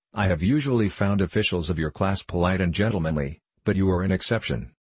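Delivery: ordinary delivery, nothing marked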